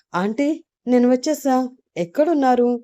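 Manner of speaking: announcing an arrival in a lifted voice; calling out. A narrator's reading. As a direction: call through the house for auntie. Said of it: "గట్టిగా అరుస్తూ పిలుస్తూ".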